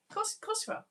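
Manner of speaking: "of course, of course you are" pitched high in playful outrage; a high squeaky mock protest; mock indignant. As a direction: tease them with fake exasperation. Mock outrage at puppet news.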